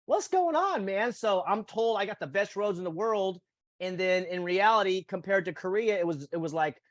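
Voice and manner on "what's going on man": much higher, startled exclaim; mock astonishment. Amazed at smooth Korean roads, high astonished exclaim. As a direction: sound floored by how smooth it is. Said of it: "And I'm like".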